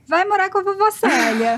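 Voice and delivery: high mock child voice, sassy; lightly imitated kid. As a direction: play the little daughter answering back. Daughter's reply voiced.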